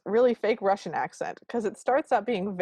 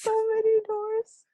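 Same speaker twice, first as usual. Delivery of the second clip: much higher, squeaky mock-amazed voice; mock awe. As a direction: squeak it out, pretending to be dazzled by the number. Mock awe at hundred doors.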